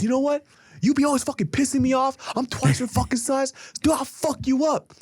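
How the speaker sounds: high, heated imitation of a hothead friend picking a fight; hammed-up bully threat, last words slowed; mock tough-guy threat. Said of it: "He goes".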